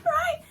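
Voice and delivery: squeaky high giggle; high laugh. Real, not acted. Laughing about tripping, high giggle. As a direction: burst out in a shrill giggle.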